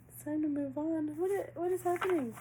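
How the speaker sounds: high-pitched bewildered questioning; baffled. Real, not acted. Reader gets a contradicting message, baffled. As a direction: read it back in high-pitched disbelief.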